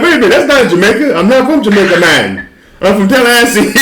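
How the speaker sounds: hammed-up Jamaican accent, high and laughing; mock Jamaican accent, laughing. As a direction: play a Tallahassee guy faking Jamaican patois through laughter. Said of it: "I actually do a better British accent".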